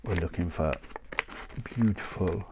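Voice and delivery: usual speaking voice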